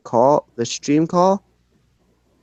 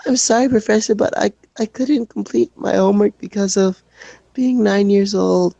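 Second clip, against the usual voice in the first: high, timid schoolkid apology; shy kid pleading; 7 semitones higher than usual. Acted. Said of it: "shy kid voice".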